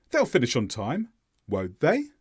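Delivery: "won't they" going up on "they"; stress on THEY. Shown as a demonstration. Teacher replays final example, not sure, "they" stressed and rising. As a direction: genuine question, lifting the final "they". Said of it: "the stress is on the subject pronoun".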